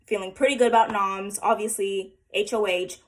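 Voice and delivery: ordinary delivery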